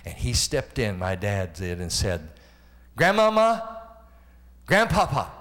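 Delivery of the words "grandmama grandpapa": high, loud call across the bedroom. Retold or imitated. Dad calling to the grandparents in bed, high and loud. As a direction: call out to the old folks with joy.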